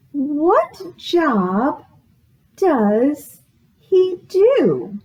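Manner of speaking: normal talking voice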